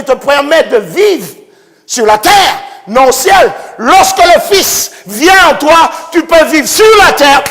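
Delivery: loud, high preacher's cry; fervent preaching. Acted. Preacher to congregation about faith, fervent shout. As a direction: proclaim faith to the crowd.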